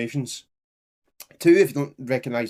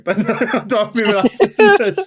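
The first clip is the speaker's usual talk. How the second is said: laughing through the words, voice jumping up; 9 semitones higher, 9 dB louder than usual. Real, not acted. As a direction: giggle out the line, real amusement. Laughs mid-sentence while reading.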